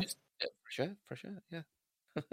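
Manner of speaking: lightly mocking echo; mock innocent murmur. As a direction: murmur it slyly.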